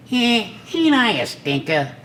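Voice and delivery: high smart-aleck cartoon rabbit; be a cocky wisecracking rabbit; Bugs Bunny. Impressionist showing off the smart aleck, high rabbit voice. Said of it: "you get the smart aleck".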